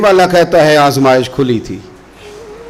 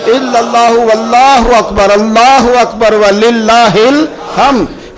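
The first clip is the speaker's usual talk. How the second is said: slow, drawn-out chant, building higher; leading takbir recitation; 4 semitones higher, 3 dB louder, much slower than usual. Shown as a demonstration. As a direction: lead the congregation, stretching each phrase. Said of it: "پڑھو نا ذرا سارے". (مجمع کو بلند آواز میں تکبیر پڑھانا)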